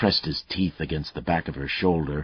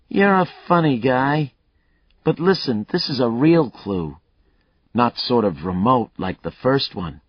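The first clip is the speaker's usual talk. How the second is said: a lightly voiced cocky killer, higher and louder; taunting killer; 8 semitones higher, 6 dB louder than usual. Killer Mayle taunting Lucas over the phone, cocky and loud. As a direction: goad the cop, enjoying himself.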